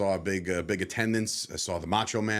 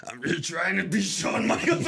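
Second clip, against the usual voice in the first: put-on a kid trying a higher wrestler voice; Shawn Michaels voice attempt; 5 semitones higher than usual. Retelling his childhood attempt at the Shawn Michaels voice. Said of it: "trying to change my voice".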